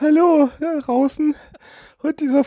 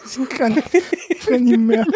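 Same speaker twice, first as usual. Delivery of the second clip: helpless giggling, barely getting words out; laughing so hard it hurts; on laughing gas. Real, not acted. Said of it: "Tränen in den Augen".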